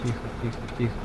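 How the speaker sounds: soft hushing to himself; quiet soothing tikho; hushed. Real, not acted. Calming the bus through turn. (тихо, успокаивающе)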